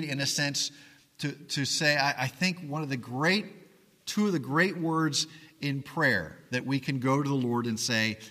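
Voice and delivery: ordinary talk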